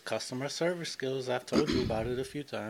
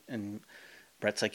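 usual speaking voice

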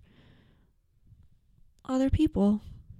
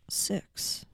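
whispered; 12 dB quieter than usual. Real, not acted. Trailing off whispered.

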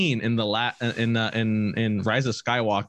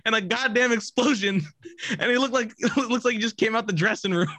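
much higher, giggling through every word; can barely get it out for laughing; laughing disbelief; 11 semitones higher than usual. Retold or imitated. Describing the unhurt movie villain, laughing high disbelief. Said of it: "And I was like".

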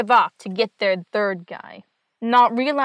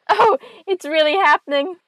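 higher, louder spooked yelp; mock fright; 6 semitones higher, 3 dB louder than usual. Butler startled by a rope, spooked higher yelp. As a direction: play a butler suddenly sure the ghost is real.

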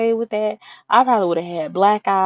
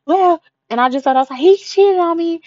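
telling her friend he cheated, pitched high and loud; upset confession; 9 semitones higher, 6 dB louder than usual. Retold or imitated.

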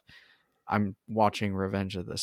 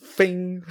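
high, ringing "fing" sound effect; imitated zap noise; 8 semitones higher than usual. Host joking about wiping out Toby, high mock sound effect. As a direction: make a playful high-pitched zing.

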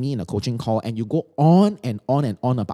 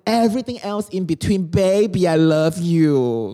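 higher, slow, sugary pleading voice; clingy girlfriend; 4 semitones higher, slower than usual. Acted. Girlfriend whining to her boyfriend that he must make her happy, syrupy. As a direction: coo at the boyfriend, drawing each word out.

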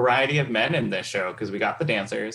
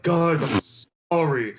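Emery Cohen impression; 3 semitones higher than usual. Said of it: "that's a pretty good Emery Cohen".

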